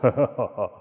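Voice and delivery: loud boisterous laugh; hearty bar laugh. Shown as a demonstration. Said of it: "voy hablando así".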